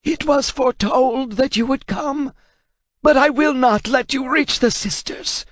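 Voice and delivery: high, strained scholar's voice; defiant translator. Acted. Translator warning Kratos, high and defiant. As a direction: refuse him though terrified.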